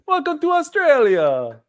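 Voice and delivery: high, slow, laughing put-on Aussie voice; a cheesy Aussie greeting, said slowly through a laugh; hammed-up Australian accent. Jokingly greets Benny from Australia.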